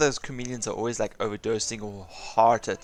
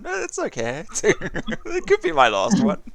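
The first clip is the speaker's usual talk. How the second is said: higher-pitched, drawn-out impression; imitating a comedian; 9 semitones higher, slower than usual. Imitating an awards host on his last one, high and drawn-out. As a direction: go up high and stretch the repeat. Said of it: "he's like".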